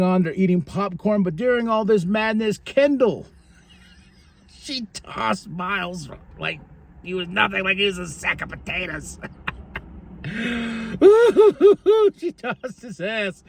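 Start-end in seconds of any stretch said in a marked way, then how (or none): 3.9-13.4 talking through real laughter; laughing in disbelief. Real, not acted. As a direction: giggle the words out. Laughing at the toss.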